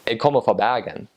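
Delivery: Bergen accent with the R scraped at the back of the throat; proud Bergen local's Western Norwegian accent; Western Norwegian dialect. Shown as a demonstration. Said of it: "if you are actually from Bergen".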